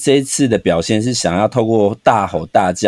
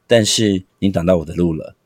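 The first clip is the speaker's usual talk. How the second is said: low and quieter, firm and cold on 但是你挡到我的路了; stern teacher; 5 semitones lower, 3 dB quieter than usual. Shown as a demonstration. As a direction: say it flat and final so the child feels scared. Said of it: "但是你刚刚是那种，".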